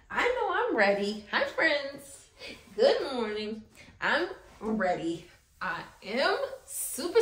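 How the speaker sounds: ordinary talk, nothing marked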